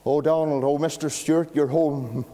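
a mother glad of a visitor, unaware; preacher voicing the cheerful mother; hopeful mother. Happy mother greets the padre.

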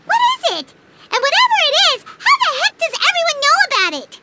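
high, stunned outburst; can't believe it, rushing; shocked. Acted. Companion stunned that everyone knew, shocked.

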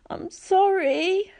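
put-on very high, slow, tearful goat-boy voice; sniffling satyr. Satyr friend apologising to Percy, high and sniffling. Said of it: "Grover sniffled".

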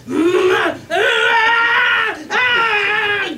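put-on high babyish yells; toddler yelling. Toddler running to dad, high excited squeals.

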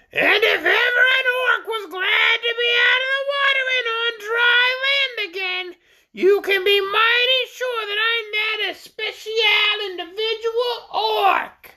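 very high, drawn-out squawky orc voice; self-important high-pitched creature; shrill creature. A narrator's reading. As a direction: proclaim it slowly in a piercing bird voice. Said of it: "high-pitched voice".

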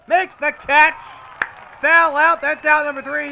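high, loud thrilled call; excited out call. Real, not acted. Commentator calls the inning-ending catch, high loud excited call. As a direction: belt out the third out. Calling the final out live.

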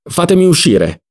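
high, urgent outcry; desperate yell. A narrator's reading. Trapped Ziddima to the onlookers, desperate yell. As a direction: cry out to be released.

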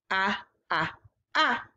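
repeated short vowel ah; Ah, ah, ah as a clear short vowel; ah sound. Shown as a demonstration. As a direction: model the ah sound. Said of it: "those ah sounds".

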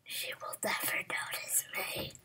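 hushed sneaky whisper. Pretend. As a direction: whisper to stay hidden.

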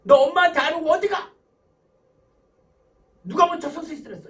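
high, loud mock telling-off; scolding mother. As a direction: act a mother challenging her child angrily. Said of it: "또 엄마가 뭐라고 혼내켜요?".

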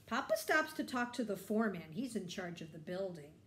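plain reading voice

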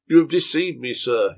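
angry. Acted. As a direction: throw the charge in his face. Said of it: "he said angrily".

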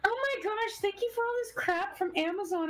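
high excited unboxing gush; gushing vlogger voice. Retold or imitated. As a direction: gush over the packages. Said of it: "It's like".

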